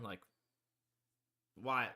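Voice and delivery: ordinary talk, nothing marked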